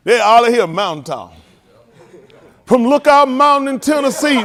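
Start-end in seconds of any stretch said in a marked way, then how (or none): 2.7-4.4 loud, high speech-maker's shout; mock oratory. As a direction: proclaim it to a huge crowd. Parodies a famous speech.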